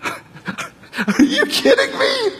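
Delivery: high, slow laughing "are you kidding me"; delighted incredulous laugh; laughing disbelief. Real, not acted. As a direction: laugh it out in amazement.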